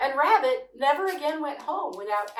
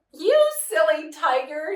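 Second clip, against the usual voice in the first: called out; 4 semitones higher, 8 dB louder than usual. A narrator's reading. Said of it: "he called".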